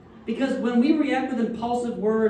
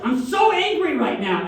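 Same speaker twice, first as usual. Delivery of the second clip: imitated fury, higher and louder; mock angry; 6 semitones higher, 5 dB louder than usual. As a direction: parody someone venting their anger. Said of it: "Just people be like".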